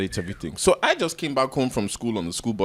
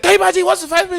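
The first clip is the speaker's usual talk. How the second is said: very high, louder appeal; mock outraged complaint; 5 dB louder, much faster than usual. Mom complaining to stepdad about child, mock outrage. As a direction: complain high and loud, as if scandalised.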